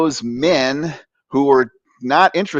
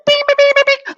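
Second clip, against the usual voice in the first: very high, loud rapid beeps; imitated alarm beeps; 4 dB louder, faster than usual. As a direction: squeal beeps like hormones sounding an alarm. Imitating racing teenage hormones.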